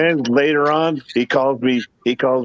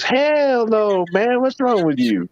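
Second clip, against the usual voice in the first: mock outrage; 9 semitones higher, much slower than usual. Friend's outraged reply retold.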